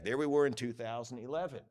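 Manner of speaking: ordinary talk, nothing marked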